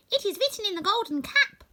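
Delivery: a tiny queen's gracious reply; squeaky mouse queen. Acted. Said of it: "her squeaky little voice".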